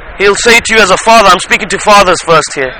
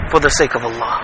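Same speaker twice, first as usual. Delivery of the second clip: much lower and softer; hushed sincerity; 9 semitones lower, 9 dB quieter than usual. Acted. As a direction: let it sink into a reverent hush. Reverent echo stressing sincere intention.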